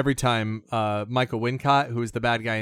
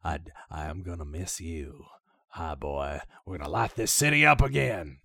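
much lower and softer, deep menacing villain murmur; Michael Wincott villain; 7 semitones lower, 4 dB quieter than usual. Retold or imitated. Imitating the villain from The Crow, in a deep low menacing voice. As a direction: growl it low like the bad guy in The Crow. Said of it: "when you hear him go".

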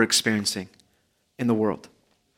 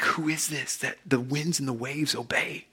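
awed hush; 11 dB quieter than usual. Retold or imitated. Disciples awed after the calm.

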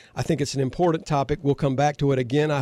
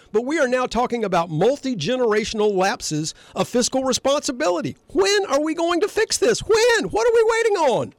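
fired-up rant; 10 semitones higher than usual. Real, not acted. Host to co-host and listeners, fired up and demanding. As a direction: push for an answer, worked up. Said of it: "a little fired up [name]".

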